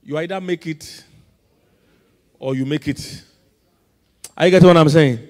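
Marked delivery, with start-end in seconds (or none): 4.4-5.2 shouted check on the congregation; loud demand. Real, not acted. Preacher asks if congregation is following, loud demand. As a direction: call out forcefully for a response.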